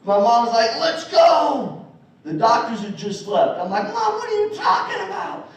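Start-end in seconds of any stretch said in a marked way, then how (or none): 0.8-1.8 high, loud rousing call; retold urgent shout; imitated mom's order. Mom voiced ordering son up. 3.9-5.5 put-on high-pitched, baffled kid; imitated bewildered child; young boy voice. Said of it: "I had a higher voice".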